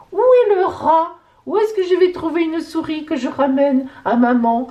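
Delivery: excited high voice of a mousing pet; playful pet's voice. Acted. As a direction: speak for the cat, pitched right up. Voices the cat hunting for mice.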